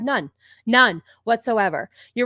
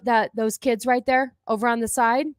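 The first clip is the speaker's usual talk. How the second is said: ordinary talk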